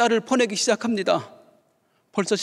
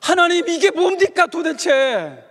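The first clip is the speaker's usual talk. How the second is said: complaining to God; 9 semitones higher, 5 dB louder than usual. Acted. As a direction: cry out at heaven in exasperation. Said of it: "하나님을 향해 원망했습니다".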